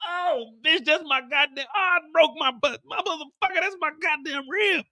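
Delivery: high strained groaning curses; the hitman lying run over; pained cursing. Acted. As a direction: curse through broken ribs.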